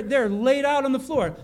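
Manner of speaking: ordinary talk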